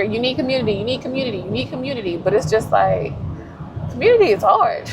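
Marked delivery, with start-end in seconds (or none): 0.0-2.2 preacher's refrain. Retold or imitated. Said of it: "from the pulpit, you hear". 3.9-4.9 exclaiming. Real, not acted.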